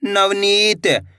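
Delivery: Navneet drawn out slowly; slow blended word. Shown as a demonstration. Teacher blending the syllables slowly. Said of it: "तो मिलके बन जाएगा".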